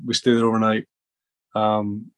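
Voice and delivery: ordinary talk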